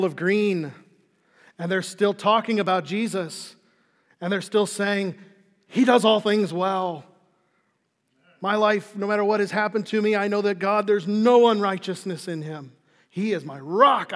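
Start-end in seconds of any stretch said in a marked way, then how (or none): 5.7-14.1 loud, ringing declaration of trust; fervent testimony. Acted. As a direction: proclaim it with full conviction, voice raised. Preacher voices the elders' fervent testimony.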